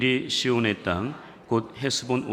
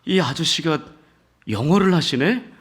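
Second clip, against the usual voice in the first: surprised; 6 semitones higher, 4 dB louder than usual. Retold or imitated. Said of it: "이 미국인이 깜짝 놀란 거예요".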